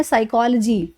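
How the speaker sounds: "साइकोलॉजी" as sai-KOL-uh-jee; silent P, reduced -logy. Shown as a demonstration. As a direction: start "साइकोलॉजी" on S, short uh in the ending. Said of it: "इसको हम बोलते हैं".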